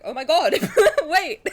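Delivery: high, loud exclamation breaking into laughter; laughing disbelief. Retold or imitated. Fan retelling the moment the trailer dropped, laughing disbelief. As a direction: laugh through your own shock.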